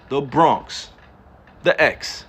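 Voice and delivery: Bronx pronounced with a hard New York street accent. Shown as a demonstration. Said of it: "talk like this on a regular".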